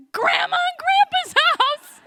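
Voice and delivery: put-on high, loud, slow exasperated kid's shout; annoyed child shout. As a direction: snap it like the question is stupid. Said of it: "annoyed by what I thought was a really stupid question".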